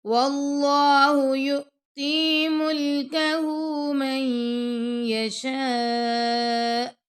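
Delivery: slow, measured tajweed chant; Quran recitation. Shown as a demonstration. Teacher reciting the verse to students, slow and melodic. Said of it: "ادغام اور غنہ کرنا ہے".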